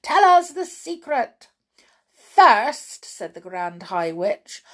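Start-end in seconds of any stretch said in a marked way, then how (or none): 0.0-1.5 high, loud impatient witches; impatient cry. A narrator's reading. Witches to their leader, impatient cry. Audience pleading. 2.1-3.1 slow, louder commanding witch. A narrator's reading. Grand High Witch to witches, slow commanding witch voice.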